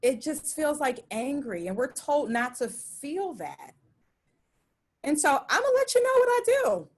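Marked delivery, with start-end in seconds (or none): 5.0-6.9 much higher, louder and quick, bursting with energy; excited announcement. Real, not acted. Speaker pitches to audience, eager and bright. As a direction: gear up to reveal your secret.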